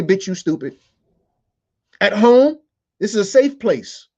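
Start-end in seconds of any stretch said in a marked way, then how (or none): 0.0-0.8 put-on kid's line. Shown as a demonstration. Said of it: "You can say".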